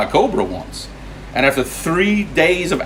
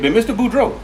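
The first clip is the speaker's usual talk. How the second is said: high, put-on cop voice in Cajun accent; high mock lawman calling out Boudreaux; cop in a joke; 9 semitones higher, 4 dB quieter, faster than usual. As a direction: play the scolding cop, pitched way up. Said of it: "He said".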